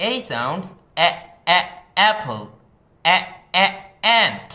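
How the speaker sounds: loud, high এ, অ্যাপল and অ্যান্ট, each clipped; short a sound. Shown as a demonstration. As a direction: bark out the short এ for the class. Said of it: "এই এর sound কিরকম?". (জোরে ছোট এ উচ্চারণ)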